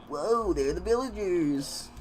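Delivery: slowed, lightly voiced trailer imitation; mock trailer voice. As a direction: drawl it out like a movie trailer. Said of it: "Minecraft be like".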